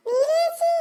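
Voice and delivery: calling out. Acted. Returning to big sister with news, calling out. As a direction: call the name out high to announce yourself.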